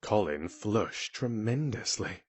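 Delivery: usual speaking voice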